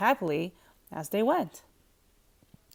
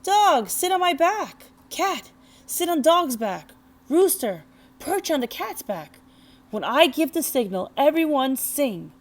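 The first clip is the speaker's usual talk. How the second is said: calling out; 5 semitones higher, 4 dB louder than usual. A narrator's reading. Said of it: "and called".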